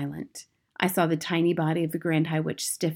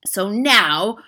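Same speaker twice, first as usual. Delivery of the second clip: barking; 3 semitones higher, 7 dB louder than usual. Acted. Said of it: "barked the Grand High Witch".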